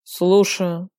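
drowsy, just-woken murmur; sleepy voice. A narrator's reading. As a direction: answer the phone half asleep. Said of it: "произнёс сонным голосом".

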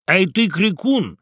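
high, loud heckling of a guest; loud demanding crowd cry; rowdy shout. A narrator's reading. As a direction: shout him into drinking. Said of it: "закричали в один голос все птицы".

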